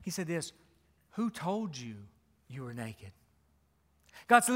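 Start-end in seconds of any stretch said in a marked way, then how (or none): 1.1-3.1 a soft, hushed question, fading away. Acted. God gently asking the ashamed man, softly. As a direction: ask it gently, almost under the breath.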